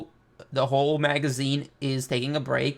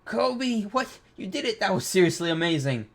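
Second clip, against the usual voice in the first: excited; 6 semitones higher than usual. Acted. Reading excited character praise.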